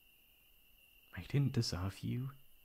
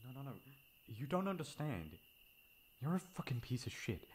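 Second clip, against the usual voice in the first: cold contempt; 4 semitones higher, 6 dB quieter than usual. Acted. On phone to the ex.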